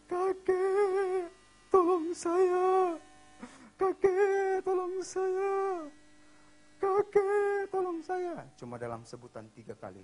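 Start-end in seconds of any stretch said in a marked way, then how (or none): 0.1-8.5 very high, loud, drawn-out wail; child crying for help. Retold or imitated. Boy calling his grandfather to rescue him, wailing scream. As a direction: wail the plea over and over.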